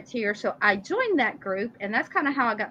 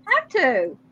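laughing; 7 semitones higher, 3 dB louder than usual. Real, not acted. Laughing after pirate growl.

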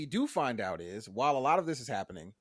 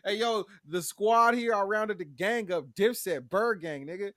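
high, loud swaggering gang leader call; hyped-up Bev; 6 semitones higher, 6 dB louder than usual. Retold or imitated. Bev hollers to the pastor, pitched-up loud swagger. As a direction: announce the squad like a hype man, pitched up and loud. Bev voiced leading zombie horde.

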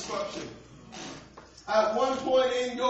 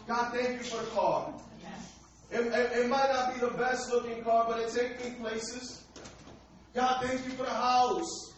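prayer. Acted. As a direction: pray it humbly, word by word. Leads a thanksgiving prayer.

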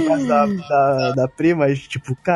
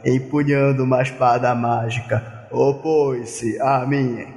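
Abu voice; slower than usual. Acted. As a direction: boom it out as the dark master. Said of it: "tem que falar com a voz do Abu".

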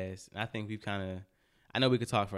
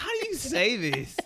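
confused high question; 14 semitones higher, 5 dB louder than usual. Retold or imitated. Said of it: "I was like".

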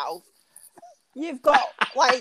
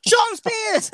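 high, raised imitation of a call-out; mock wrestler call-out; 6 semitones higher, 4 dB louder than usual. Co-host imitating a wrestler calling out Shawn Spears, mock call-out. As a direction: call him out in a higher, playful voice. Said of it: "I love how he's like".

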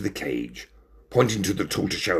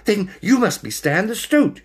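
tag; 12 semitones higher, 4 dB louder than usual. A narrator's reading.

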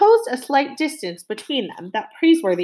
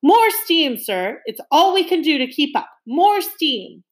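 restrained bellow, pitched up; 7 semitones higher, 3 dB louder than usual. A narrator's reading. Captain calling to the engine room for steam, bellowing. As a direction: call down the voice tube, raised and urgent. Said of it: "bellowed to the engine room below".

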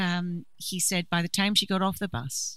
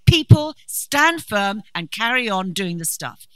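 preaching shout; 3 semitones higher, 10 dB louder than usual. Acted. Preacher rallying the congregation with a loud, slow call. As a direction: call the church to stand firm at full force.

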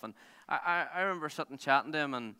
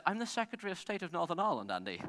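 lightly imitated English accent, higher at the start; 5 semitones higher than usual. English politician introducing himself to a rally driver, mock English accent. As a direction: quote him in a mock English accent, dropping on the name. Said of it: "He's some English guy".

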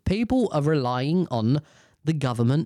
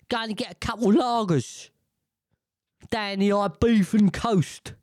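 mock Brit tourist; 5 semitones higher than usual. Mocking a holiday-goer.

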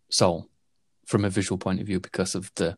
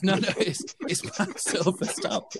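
higher, hammed-up panicked protest; half-laughing, pitched-up plea to stop; mock alarm; 9 semitones higher than usual. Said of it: "then he realises".

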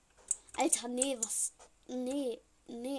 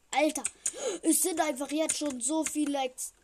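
panicked; 3 semitones higher, 7 dB louder than usual. Real, not acted. Everyone turned Piggy but him.

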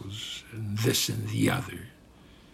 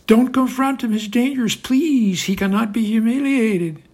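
much higher, louder mock-whiny panic; mocking panicky leaders; 9 semitones higher, 9 dB louder than usual. Putin mocking frightened Western leaders, shrill panic. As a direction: ham up scared leaders pleading. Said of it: "run around in circles crying".

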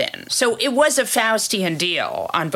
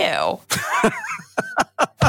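real high-pitched laugh. Real, not acted. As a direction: burst of squeaky laughter. Laughs after swearing.

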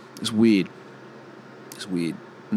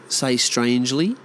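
ordinary talk

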